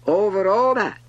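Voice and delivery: high, very loud grand declaration; imperious king. A narrator's reading. King answering the prince, loud and grand. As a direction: proclaim it with total authority. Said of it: "the king answered".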